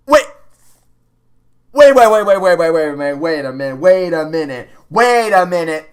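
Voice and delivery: loud, rapid wait wait wait; frantic stopping. Real, not acted. Player reacting to the skipped story, frantic. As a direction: halt everything in panic.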